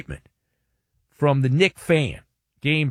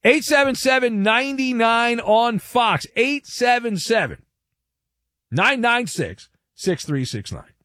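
radio number read; 7 semitones higher than usual. Acted. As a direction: announce the number like a jingle. Reads out the call-in number.

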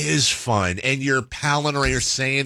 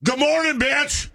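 high-pitched imitated yell; yelled wake-up greeting; 14 semitones higher than usual. Friend waking up after pounding a screwdriver, imitated yell. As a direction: holler it high like a hungover friend. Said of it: "and then yell out".

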